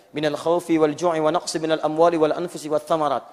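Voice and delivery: chanted Arabic verse; Quran recitation. Acted. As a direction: recite the ayah in a measured chant.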